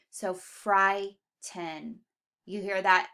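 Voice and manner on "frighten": FRIGHTEN very slowly split, "FRIGH... ten"; split at syllables. Shown as a demonstration. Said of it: "we divide between that I G H".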